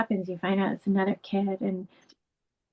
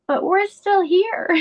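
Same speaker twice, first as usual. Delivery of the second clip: put-on high, slow, gentle child's voice; imitating her little son; 12 semitones higher, 4 dB louder, slower than usual. Mother quoting her six-year-old son, in a high slow child voice.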